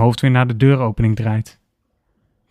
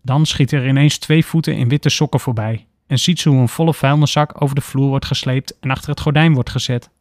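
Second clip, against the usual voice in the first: usual speaking voice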